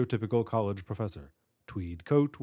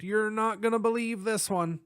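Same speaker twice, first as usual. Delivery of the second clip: excited cop; 10 semitones higher than usual. Acted. Cop voiced in story.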